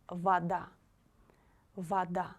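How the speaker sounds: вода said "va-DA", first O as "ah". Shown as a demonstration. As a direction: model вода with the unstressed O turned into A. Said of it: "we pronounce the letter O like A".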